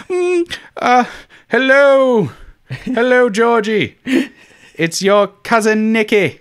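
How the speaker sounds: high, sing-song British greeting to a royal cousin; posh British accent. Retold or imitated. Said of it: "He just has, like, a British accent ... just nailed".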